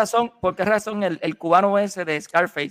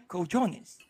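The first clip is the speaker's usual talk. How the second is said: put-on English accent; 11 dB quieter than usual. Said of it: "¿Cómo es?".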